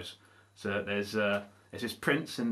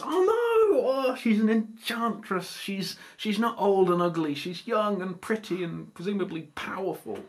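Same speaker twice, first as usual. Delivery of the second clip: mock dismay; 9 semitones higher than usual. Prince discovering she's an enchantress, mock-dismayed wail.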